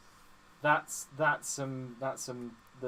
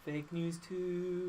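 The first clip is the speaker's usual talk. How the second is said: soft, drawn-out segment sting; mock jingle; 2 semitones higher, 6 dB quieter, much slower than usual. Co-host labels the second story, slow soft mock jingle. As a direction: stretch it out softly like a sting.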